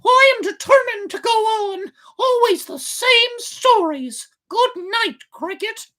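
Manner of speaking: very high, loud puppet voice brushing off advice; high puppet voice, stubborn and impatient. A narrator's reading. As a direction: wave off the nagging like a stubborn boy. Pinocchio answers the cricket.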